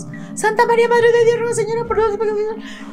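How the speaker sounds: fast high gabbled Hail Mary; hurried loud praying; rushed prayer. Retold or imitated. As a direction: rattle off the prayer. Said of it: "bien rápido".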